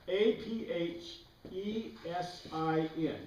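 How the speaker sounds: each letter of A P H E S I N slow and separate; slow spelling for the class; spelled letter by letter. Shown as a demonstration. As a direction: spell it out slowly, one letter at a time. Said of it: "Aphesis is the basic word".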